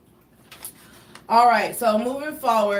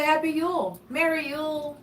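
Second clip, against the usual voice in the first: higher, sugary reply; lightly sing-song pointed greeting, higher; sweet correction; 7 semitones higher than usual. Shown as a demonstration. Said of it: "I just say".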